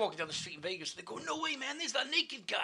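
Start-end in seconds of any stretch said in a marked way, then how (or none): 1.3-2.6 high-pitched imitation of amazed strangers; excited passer-by. Strangers spotting him on the Vegas street, excited. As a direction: pipe up as a gawping stranger.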